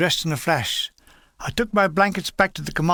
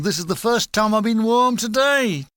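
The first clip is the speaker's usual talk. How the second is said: grinning shout; 5 semitones higher, 4 dB louder than usual. Retold or imitated. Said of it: "grinning and shouting".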